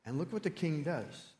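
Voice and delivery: usual speaking voice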